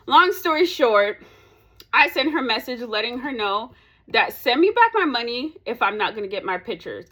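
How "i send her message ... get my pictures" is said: usual speaking voice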